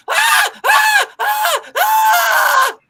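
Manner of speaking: very high, loud mock screams; screaming. Contestant screaming while alone in the maze, imitated screams. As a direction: scream out in terror. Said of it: "I hear her screaming the entire time".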